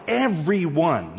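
slow, high "Everyone", syllable by syllable; "Everyone" drawn out as the clincher; emphatic EVERYONE. Shown as a demonstration. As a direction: stretch out "Everyone" for punch. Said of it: "I like the word everyone better".

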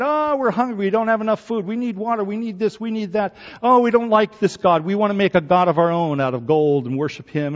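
high, whiny complaining voice; mock the complainers with a whine; whining Israelites. Israelites complaining to Moses, high mocking whine. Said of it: "They whined and whined".

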